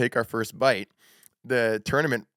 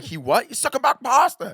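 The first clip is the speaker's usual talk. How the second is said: funny voice; 12 semitones higher, 4 dB louder than usual. Retold or imitated. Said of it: "Why is he talking like that?".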